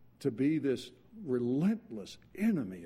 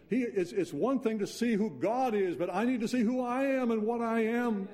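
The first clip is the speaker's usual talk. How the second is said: higher, louder, rising fervor; fervent preaching; 9 semitones higher, 3 dB louder than usual. Real, not acted. Preacher pressing the point to the congregation, fervent and building. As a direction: build the sermon to a passionate peak.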